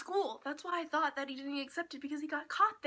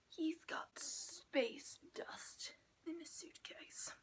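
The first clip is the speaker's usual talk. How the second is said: whisper; 2 semitones higher, 10 dB quieter, much slower than usual. Real, not acted. Whispered aside.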